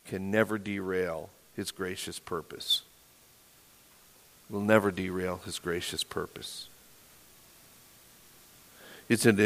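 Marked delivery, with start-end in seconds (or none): 0.1-2.8 quiet emphasis. Real, not acted. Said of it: "repeated for emphasis". 4.5-6.7 softer, lower reaffirmation; hushed emphasis. Real, not acted. Pastor reassuring the congregation, hushed and low. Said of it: "repeated for emphasis".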